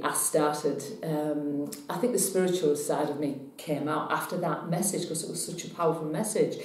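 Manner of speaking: usual speaking voice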